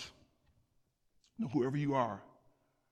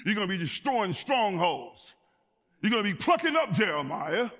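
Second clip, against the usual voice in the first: full-force preaching; 6 semitones higher, 7 dB louder than usual. Acted. God charging Jeremiah and the church, full-force preaching. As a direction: build higher and louder to a ringing peak.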